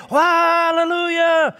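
high, drawn-out hallelujah, held on one high note; excited praise shout. Retold or imitated. Preacher imitating David having a shouting spell, jubilant praise. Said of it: "a shouting spell".

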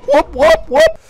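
very high, loud whooping; celebratory whoops. Real, not acted. Gamer cheering his kill, high celebratory whoops. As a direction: whoop sharply upward in triumph.

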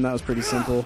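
ordinary talk

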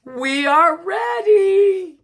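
high, loud announcement, slowly stretched; ringing, drawn-out call. Acted. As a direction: announce it big and proud. Character calls out to party.

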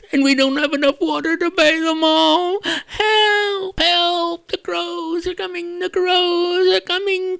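high squeaky cries for help; panicking raccoon. Acted. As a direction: wail like a frightened raccoon.

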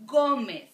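"Gómez" with a loud, punched first syllable; emphatic model of "Gómez", strong first syllable; next-to-last syllable stressed. Shown as a demonstration. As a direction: hit GÓ hard in "Gómez". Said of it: "in the next to last syllable".

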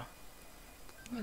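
hushed, slow, breathless oh my God; whispered fear. Real, not acted. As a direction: whisper it in dread. Scared player whispering.

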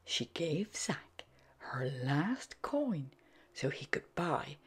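soft hushed bedtime-story read; gentle whispered narration; whispered storytelling. A narrator's reading.

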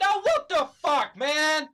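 loud, high-pitched rage yell; angry outburst. Real, not acted. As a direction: shout in furious disbelief. Player rages at the game.

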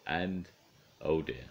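ordinary delivery

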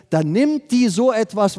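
ordinary delivery

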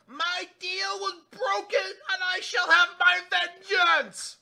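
high, slow, swelling to a furious shout; vengeful Red Queen. Acted. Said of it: "she goes".

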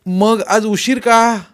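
loud, high, drawn-out demand; scolding mother. A narrator's reading. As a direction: press a late child for an explanation. Mother demands why he is late.

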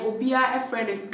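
normal talking voice

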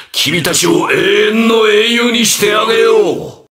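high, ringing villain offer; grandiose proclamation. Acted. Villain offering heroes eternal glory, high grandiose voice.

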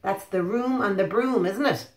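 plain reading voice

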